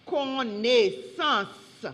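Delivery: slow, deliberate dictation; spelling bee word, drawn out; dictating a word. Real, not acted. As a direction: say it slowly and carefully for a speller.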